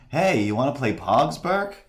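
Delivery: lightly voiced nerdy kid, rising high on the name; a hint of a cartoon kid asking eagerly; quick nod to a cartoon boy; Milhouse line. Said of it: "go to the Millhouse".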